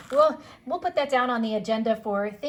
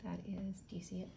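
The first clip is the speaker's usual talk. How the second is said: whispering; 5 semitones lower, 13 dB quieter than usual. Real, not acted. Admiring the stone quietly.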